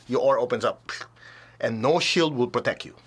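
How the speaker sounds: ordinary talk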